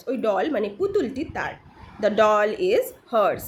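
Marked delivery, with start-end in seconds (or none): 2.0-3.4 very slow, separated words; deliberate teacher's example reading; repeat-after-me model. Shown as a demonstration. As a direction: model it slowly for learners to copy. Teacher models sentence for repetition.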